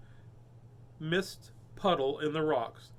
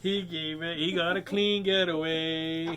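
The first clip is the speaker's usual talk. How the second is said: slow pun; 3 dB louder than usual. Pretend. Building the pun.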